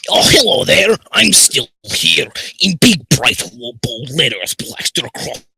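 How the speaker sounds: story read in a loud ogre voice; Shrek. Acted. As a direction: ogre impression, starting at a shout and easing off. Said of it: "we're not doing the Shrek voice".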